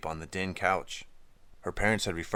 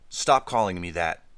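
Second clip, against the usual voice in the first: sharp raised command; loud snap; 7 dB louder than usual. A narrator's reading. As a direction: bark it out angrily. Gabriella snaps at Layla.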